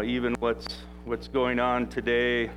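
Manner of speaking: normal talking voice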